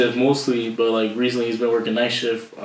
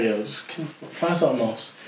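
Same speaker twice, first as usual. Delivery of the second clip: usual speaking voice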